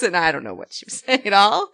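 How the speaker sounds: usual speaking voice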